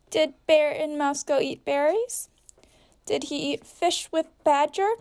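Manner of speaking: usual speaking voice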